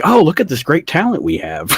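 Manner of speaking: put-on gushing; mock amazement. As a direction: feign sudden delight in a discovery. Mocking quote of the company.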